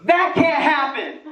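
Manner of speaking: put-on high, slow, outraged old lady; indignant old lady. Old lady at the ATM refusing to believe, outraged. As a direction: drag it out in disbelief. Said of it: "She goes".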